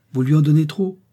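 sharp indignant reproach; outraged. A narrator's reading. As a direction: scold her for overfeeding. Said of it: "outrée de ne pouvoir intervenir".

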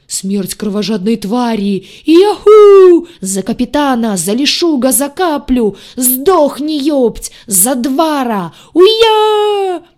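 booming inhuman battle roar; eldritch horror. Acted. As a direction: bellow it like an unearthly monster. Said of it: "выкрикивая гулким, нечеловеческим голосом". (гулкий нечеловеческий боевой клич)

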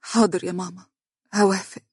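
a touch of surrender; understated, submissive daughter; resigned. A narrator's reading. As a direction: give in meekly. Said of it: "قالت دنيا باستسلام".

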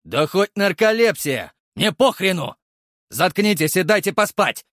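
loud, irritated neighbour snarl; grumpy angry shout. Acted. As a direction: yell to be left alone. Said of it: "буркнул недовольный сосед".

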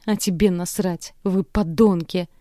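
angry shout; yelling. Acted. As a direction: spit out Вы подонки slowly. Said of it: "Продолжаю кричать".

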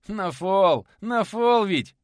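high, loud delighted cry; gleeful crowing. Acted. Nikodim showing off his bricks, high loud glee. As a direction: crow it with glee. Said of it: "Довольно расхохотался".

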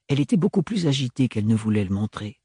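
plain reading voice

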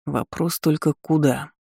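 slow, pondering drawl; thoughtful drawl. Acted. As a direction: stretch it out, thinking aloud. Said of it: "задумчиво протянул он".

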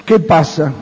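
ordinary delivery

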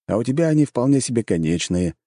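usual speaking voice

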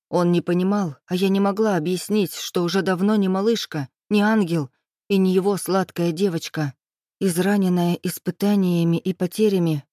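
plain reading voice

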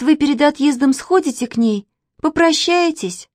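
put-on high-pitched, piteous little girl; pitying, imploring child; plaintive pleading. A narrator's reading. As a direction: coax him with pity, voice held high. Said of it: "жалобно сказала Катя".

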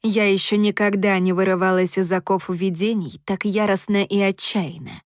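plain reading voice

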